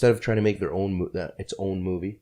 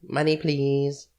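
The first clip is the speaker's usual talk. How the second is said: high, drawn-out sing-song begging; mock spoiled girl; 7 semitones higher, much slower than usual. Joining friends' teasing of those chicks, mock spoiled-girl voice. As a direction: stretch it out in a high put-on voice.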